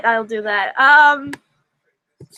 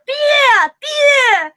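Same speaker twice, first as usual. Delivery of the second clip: much higher, louder cartoon German shout; shouting beer; 10 semitones higher, 4 dB louder than usual. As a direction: bellow for beer like a drunk German. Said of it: "try to do a Germans and beer".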